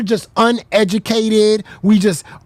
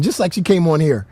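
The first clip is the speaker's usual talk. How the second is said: usual speaking voice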